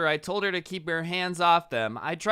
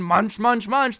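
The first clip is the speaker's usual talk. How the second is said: exaggerated munching sounds; 4 semitones higher, 6 dB louder, slower than usual. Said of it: "He's like".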